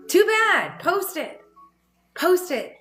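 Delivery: firm insistence. Retold or imitated. Answering her own reluctance.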